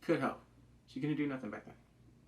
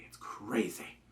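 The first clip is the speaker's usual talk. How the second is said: whispered aside; 5 semitones lower, 5 dB quieter, slower than usual. Real, not acted. Aside about a movie.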